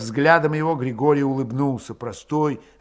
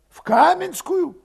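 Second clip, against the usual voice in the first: high, loud urgent character voice; agitated villager; 5 dB louder, slower than usual. A narrator's reading. Urging his comrade to leave the village, agitated.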